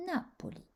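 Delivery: Napoli with the stress up front on NA; stress on third-last syllable. Shown as a demonstration. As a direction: hit NA in Napoli, let -poli fall away. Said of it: "la troisième syllabe avant la fin". (accent tonique sur « Na » dans Napoli)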